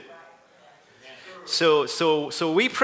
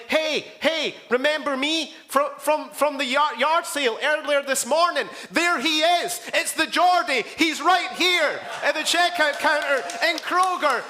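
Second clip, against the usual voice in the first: high-pitched, hammed-up excited hollering; excited calling out; 8 semitones higher than usual. Grandma spots the Geordie in Kroger, excitedly calling out. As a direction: call out as an imitated thrilled grandma.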